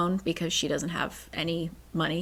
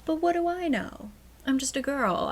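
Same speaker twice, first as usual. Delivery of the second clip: high, mock-clueless little protest; mock innocence; 6 semitones higher than usual. Brushing off her suspicion about the paint, in high mock innocence. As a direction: play innocent in a higher pitch.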